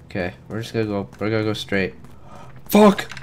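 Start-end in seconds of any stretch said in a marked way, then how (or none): 2.6-3.1 startled yell. Real, not acted. Jump scare in horror game.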